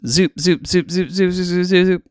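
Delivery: quick run of high zoops; rapid zoops. A narrator's reading. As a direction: fire them off like a machine gone wild.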